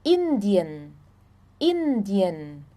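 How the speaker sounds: Indien with a clear i, IN-di-en; German pronunciation. Shown as a demonstration. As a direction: model Indien clearly, syllable by syllable.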